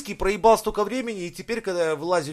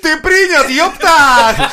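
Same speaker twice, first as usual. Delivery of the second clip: high, loud street-lad voice; gopnik voice; 10 semitones higher, 10 dB louder, much slower than usual. Retold or imitated. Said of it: "а ему переводят".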